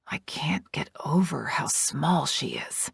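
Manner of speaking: soft whisper full of wonder; hushed, amazed whisper over a newborn; awed whisper. A narrator's reading. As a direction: whisper it as if the baby might wake. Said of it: "he whispers in awe".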